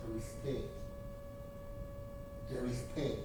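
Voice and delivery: ordinary delivery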